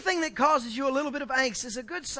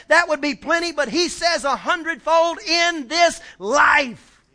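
loud, high, emphatic sermon shout; full-force preaching; 6 semitones higher, 9 dB louder than usual. Real, not acted. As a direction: drive each phrase home at full volume.

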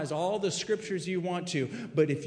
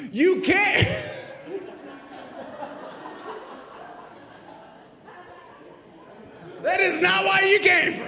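laughing shout; 13 semitones higher than usual. Real, not acted. Pastor joking to the full sanctuary, shouting and laughing. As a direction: yell it while cracking up.

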